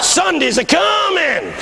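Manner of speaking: high, slow, drawn-out proclamation; imitated preacher's cry. Old pastor proclaims his one line, high and drawn out. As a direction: stretch it out high as a promise. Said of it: "And he started".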